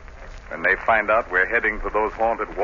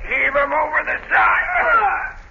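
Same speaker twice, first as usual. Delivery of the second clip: rough crew yelling together; shouted orders. Acted. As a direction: bark it out over the deck. Sailors shouting while throwing skipper overboard.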